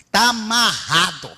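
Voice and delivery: slow, drawn-out binding command; slow rebuke. Retold or imitated. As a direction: bind the demon slowly. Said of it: "ela falou assim".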